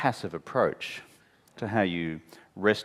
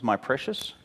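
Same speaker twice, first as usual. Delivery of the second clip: a light hint of Gollum; quick Gollum-style aside; passing nod to Gollum's hiss; 5 semitones higher than usual. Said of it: "you remember Lord of the Rings".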